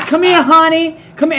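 high loud coaxing call; hollered honey. Retold or imitated. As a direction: sweet-talk him over. Woman beckoning him over.

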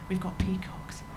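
hushed throwaway punchline; whispered aside. Real, not acted. Comic whispering the garden punchline to the crowd. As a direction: whisper it like a secret. Punchline about rural Bristol.

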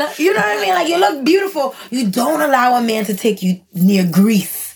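imitated mom with thick Haitian accent; heavy Haitian accent. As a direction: lecture like a Haitian mother. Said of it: "in her very heavy Haitian accent".

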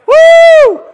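very high, loud "woo"; full-volume falsetto whoop; celebratory whoop. Pretend. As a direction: let out a piercing cheer.

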